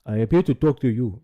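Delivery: mocking the guest's Nigerian accent; imitated Nigerian accent. Streamer mocking a guest, loud mock Nigerian accent. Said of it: "Homeboy got the thickest fucking accent".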